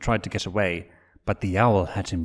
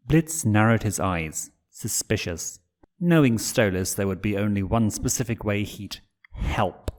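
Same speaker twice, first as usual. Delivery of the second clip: usual speaking voice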